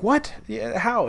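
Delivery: high incredulous yelp; stunned disbelief. Retold or imitated. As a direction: pitch leaps way up in mock shock. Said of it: "I was just like".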